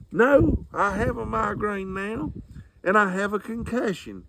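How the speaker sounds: hammed-up injured mama complaining; mama's voice. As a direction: whine like a hurt, cranky mother.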